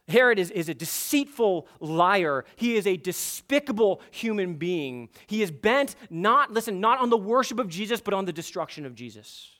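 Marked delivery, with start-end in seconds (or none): none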